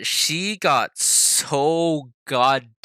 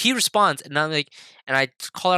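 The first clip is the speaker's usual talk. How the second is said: ordinary delivery, nothing marked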